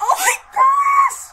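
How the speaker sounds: screaming high and loud with joy; excited shriek. Real, not acted. Fan screaming at the TV proposal, joyful shriek.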